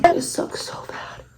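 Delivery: whispered complaint, drawn out; whispered aside. Real, not acted. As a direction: whisper it slowly like a private grumble. Aside about a failed lash.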